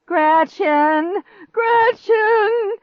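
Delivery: very high, loud scream, climbing; frantic cry. A narrator's reading. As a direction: scream the name desperately. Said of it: "He sounded more frantic than ever".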